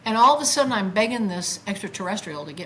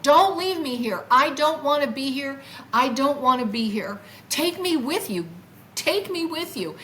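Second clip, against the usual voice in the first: higher-pitched, insistent retold begging; out-loud plea; 6 semitones higher, 4 dB louder than usual. Retelling how she begged the craft to take her, pleading. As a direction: beg out loud to be taken along. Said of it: "I literally said this out loud".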